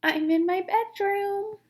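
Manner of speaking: wolf faking grandma's voice; strange wobbly voice. Acted. Wolf pretending to be Grandma from the bed, strange wobbly voice. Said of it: "in a strange, wobbly voice".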